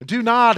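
"Do not" punched high and loud; emphatic stress. Shown as a demonstration. Preacher stressing Greek word order, loud emphatic "Do not". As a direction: hit "Do not" hard up front. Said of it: "it's moved to the front there, emphatically".

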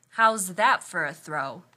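loud, slow boasting question; proud boast. Acted. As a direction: show off to a crowd. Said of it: "the limo driver proudly asked".

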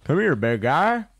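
cooing to a rabbit; coaxing call. Real, not acted. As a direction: lure the animal in. Calling animal.

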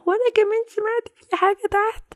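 put-on high small child's voice, worried; a kid chiming in about the noise; little child voice. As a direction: younger sibling telling the others.

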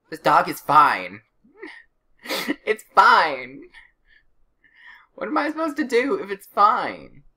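loud, rising exasperation with a laugh; exasperated outburst. Real, not acted. Exasperated at a healthy game dog.

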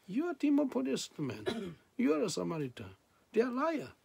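high-pitched imitation of accusers; mock accusation. Imitates accusers taunting Jesus.

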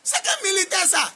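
1 shouted insistence. Real, not acted. Preacher insists on true soldiers.

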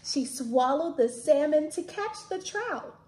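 reading a picture book aloud. A narrator's reading.

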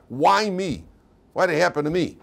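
acted anguish, pitched high; anguished protest. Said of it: "The pain, the anguish".

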